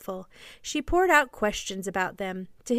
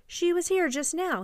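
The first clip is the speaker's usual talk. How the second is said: higher-pitched, mildly impatient reply; a little impatient; 8 semitones higher than usual. A narrator's reading. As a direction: answer with a touch of impatience. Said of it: "he said a little impatiently".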